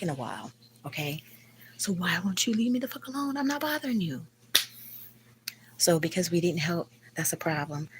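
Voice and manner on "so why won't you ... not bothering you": plead, worn out, rising high; exasperated plea. Real, not acted. Asking the landlady to leave her alone, exasperated and high. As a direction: pitch climbing high on a fed-up question.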